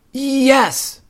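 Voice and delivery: high, loud little bear cub; eager cub. A narrator's reading. Brother Bear starts the whopper for Mama, eager. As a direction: pipe up like a fibbing child. Cubs voice.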